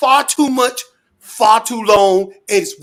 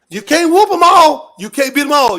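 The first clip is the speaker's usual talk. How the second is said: loud warning; 5 semitones higher, 6 dB louder than usual. Real, not acted. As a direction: spell out the warning loudly. Warning about demons.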